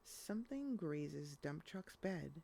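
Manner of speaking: ordinary delivery, nothing marked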